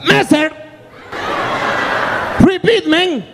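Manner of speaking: high, cocky summons to a waiter; arrogant. Acted. Cocky Barranquillero summoning the waiter in bad English, arrogant high call. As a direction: call for service with swaggering arrogance. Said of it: "fue con una prepotencia".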